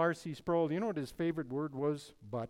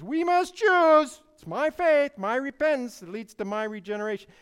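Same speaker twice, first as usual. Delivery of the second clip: mocking a man who thinks he chooses; 9 semitones higher, 12 dB louder than usual. Preacher mimics the man who says he must choose, in a loud mocking voice. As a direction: shout it out as a self-assured believer. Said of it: "that man says".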